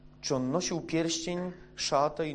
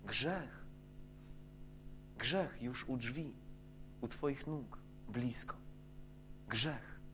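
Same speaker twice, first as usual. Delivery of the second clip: hushed voice of God; 2 semitones lower, 11 dB quieter than usual. Acted. God warning Cain in a hushed, soft voice. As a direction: lean in and warn softly, as if sin waits at the door. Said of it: "Jak było powiedziane Kainowi".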